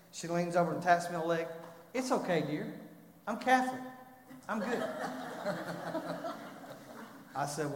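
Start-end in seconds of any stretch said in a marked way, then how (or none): 1.9-4.8 higher, lightly voiced old lady brushing it off; sweet old grandmother. Voicing grandmother's gentle brush-off. 4.9-7.3 quick airy chuckle at the punchline; amused breath of laughter; breathy laugh. Real, not acted. Laugh after grandma punchline.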